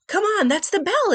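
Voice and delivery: high, eager call to go; urgent call. Acted. Antonio hurrying Sam to class at the bell, eager.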